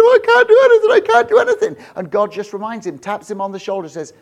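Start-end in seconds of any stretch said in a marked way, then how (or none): 0.0-1.8 very high, loud desperate wail; Moses crying out. Acted. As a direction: cry out helplessly, close to breaking. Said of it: "Moses is crying out".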